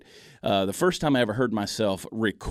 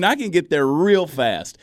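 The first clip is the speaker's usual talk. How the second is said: drawn-out, loud Southern drawl, pitched up; thick Southern accent; 8 semitones higher, 6 dB louder, slower than usual. Shown as a demonstration. Comedian repeating his boast to the host, thick Southern accent. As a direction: lean hard into the Southern accent, slowly. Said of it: "Now, my Southern accent".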